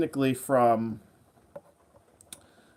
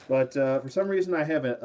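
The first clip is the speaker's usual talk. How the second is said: ordinary talk, nothing marked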